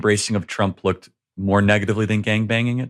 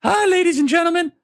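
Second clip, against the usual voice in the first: much higher mock voice breaking into a laugh; a much higher, laughing parody of a pilot's cabin announcement; mock nervous pilot. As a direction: ham up a shaky captain announcement, much higher. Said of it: "she's just like".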